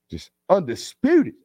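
"undisputed" drawn out high and slow with a rising end; emphatic undisputed. Shown as a demonstration. Host hyping a chat comment, stretching "undisputed". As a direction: lift and stretch "undisputed" like a hype word.

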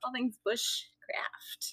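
slow, drawn-out mock whisper; playful whisper. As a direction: whisper it very slowly, as a joke. Playful echo of the joked phrase.

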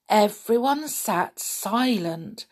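plain reading voice

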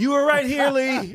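high, loud squeaky crowd voice. Retold or imitated. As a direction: squeal it like an excited fan. Crowd voice in retold bit.

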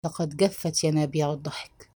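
low, subdued narrated gloom; quietly crestfallen; glum. A narrator's reading. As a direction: say it softly, sunk in gloom. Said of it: "فقال بوجوم".

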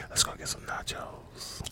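hushed whisper. Real, not acted. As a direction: whisper it under your breath. Whispered aside.

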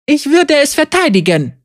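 a feeble protest she barely believes; plaintive. A narrator's reading. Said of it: "sagte Mara kläglich".

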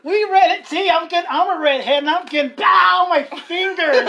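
high, loud hammed-up whining; exaggerated playful protest; mock complaining. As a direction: play the picked-on victim, half laughing. Clowning as the picked-on redhead.

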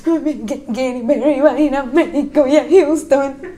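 imitating a sobbing plea; mock tearful. Daughter crying to mom about a concert, mock tearful. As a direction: whimper it out in broken, fake sobs. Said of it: "entré al cuarto llorando".

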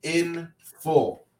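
slow, letter by letter; spelled out slowly. Shown as a demonstration. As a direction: spell each letter deliberately. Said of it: "don't want nobody to think".

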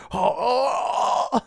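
high shouted oh oh; mock shriek. Imitating getting stuck in hatch.

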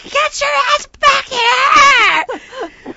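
slow, high, furious-sounding holler; retold screaming. Woman screaming at Heidi to return, frantic. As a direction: yell after someone abandoning you. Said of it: "I'm screaming at Heidi".

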